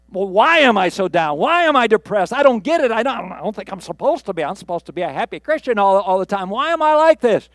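loud, high-pitched exasperated questioning; acted frustration. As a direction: argue with yourself, baffled and worked up. Acts out the downcast questioning self.

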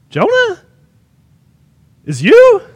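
high, rising, questioning voice; mock incredulous question. Sailors see Jonah's number come up, mock disbelief. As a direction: ask it high, as if taken aback.

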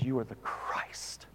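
breathless whisper of awe; whispered confession. Acted. Peter answering Jesus in an awed whisper. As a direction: hush it like a secret revelation.

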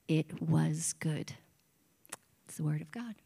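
soft, breathy whisper to close the reading. Acted. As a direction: hush the last words like a secret. Scripture reading ends in whisper.

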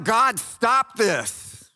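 high pleading cry, slow; anguished cry to heaven; crying out to God. Acted. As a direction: beg God to stop it. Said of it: "They cry out".

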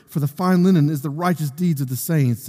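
usual speaking voice

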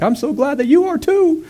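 glad king. Retold or imitated. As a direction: squeak out the king's joy in a comic high voice. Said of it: "the king was like".

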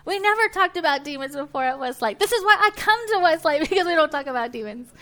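high, loud indignant alarm; alarmed churchgoer. Pretend. Preacher mocking a shocked Westlake member, high loud alarm. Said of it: "you may think".

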